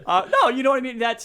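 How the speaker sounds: loud, high, laughing agreement; laughing banter. Real, not acted. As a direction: tease back at full volume through laughter. Joking back amid shared laughter.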